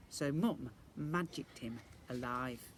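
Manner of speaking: ordinary delivery, nothing marked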